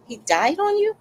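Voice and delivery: high, incredulous question; acted disbelief. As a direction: repeat it back in high-pitched disbelief. Acts own shocked reply to neighbor.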